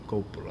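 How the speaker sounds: Coppola as "KOH-puh-luh", first syllable stressed; long O opening Coppola, stressed; Italian pronunciation. Shown as a demonstration. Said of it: "the Italian pronunciation would be".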